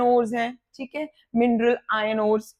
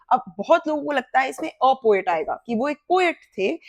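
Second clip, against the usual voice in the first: ordinary talk, nothing marked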